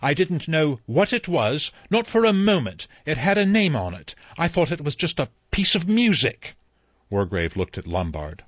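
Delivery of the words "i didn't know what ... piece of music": high, louder pleading outburst; anguished cry. Acted. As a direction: swear it's the truth with everything. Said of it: "Rogers cried".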